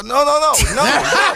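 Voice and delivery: high, rapid-fire retold protest; alarmed refusal. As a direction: fend them off in a high voice. Retold refusal of a sexual offer.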